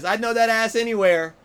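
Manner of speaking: calling out. Retold or imitated. Comedian retelling Larry leaning out the window, calling out.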